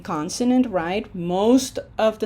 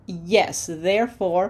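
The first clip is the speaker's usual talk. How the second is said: ordinary talk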